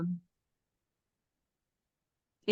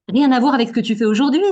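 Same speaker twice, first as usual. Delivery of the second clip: louder, quick burst of astonishment; amazed; 6 dB louder, faster than usual. Real, not acted. Host to guest whose studies don't match his career, amazed. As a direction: blurt it out fast, genuinely amazed.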